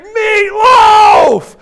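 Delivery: very high, loud rage; furious shout. Retold or imitated. Worker on the third day, in a furious screaming rage. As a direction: scream it in fury. Stomping on sandwich.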